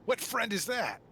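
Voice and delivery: high-pitched challenging yell; yelled question. Acted. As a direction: yell it out as a sharp demand. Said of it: "but he does yell out".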